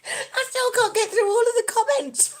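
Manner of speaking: high squeal through laughter; helpless laughing. Real, not acted. Streamer swamped by viewers' comments, laughing helplessly. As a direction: barely get the words out for laughing.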